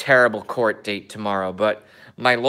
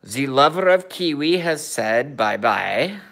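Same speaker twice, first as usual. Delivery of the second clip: a light mock Kazakh Borat accent in broken English. Vlogger joking to viewers in a light mock Borat accent. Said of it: "I love, uh, doing the accent of a Borat".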